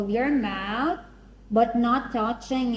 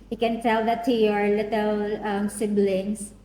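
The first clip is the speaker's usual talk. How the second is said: ordinary talk, nothing marked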